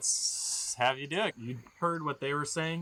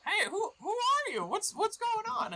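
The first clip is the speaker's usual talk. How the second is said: very high squeaky goblin. Acted. Goblin calls to the hidden players, very high and squeaky. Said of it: "they're like".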